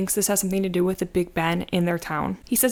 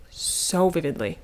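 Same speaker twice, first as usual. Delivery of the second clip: vividly stretched slowly; savoring the memory, said slowly; slow, drawn-out repeat; 3 semitones lower, slower than usual. Shown as a demonstration. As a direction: linger on so vividly.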